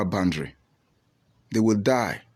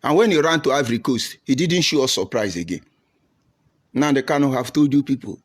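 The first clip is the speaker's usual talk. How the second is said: usual speaking voice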